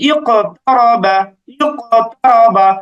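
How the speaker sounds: "ইকতারাবা" slowly, with echoed q; bouncing qalqalah. Shown as a demonstration. Teacher models qaf with sukun, slow bouncing qalqalah. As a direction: bounce the q in "ইকতারাবা" before the next syllable. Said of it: "কফে কলকলা হয়েছে".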